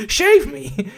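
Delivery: shave me said through laughter; laughing repeat. Retold or imitated. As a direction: laugh it out again, high. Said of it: "she's like".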